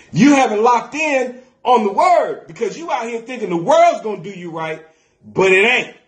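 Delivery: high-pitched, driving church preaching; impassioned preaching. Real, not acted. Preacher urges church to trust God's word, fired-up preaching. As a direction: drive each promise home to the congregation. Preaches at full force to congregation.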